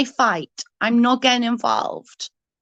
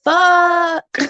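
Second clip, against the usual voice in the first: drawn-out, higher, louder disbelief; stunned disbelief; 6 semitones higher, 3 dB louder, much slower than usual. Retold or imitated. Retelling her reaction to the rival's jab, drawn-out disbelief. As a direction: stretch it out, half laughing in shock. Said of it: "I was like".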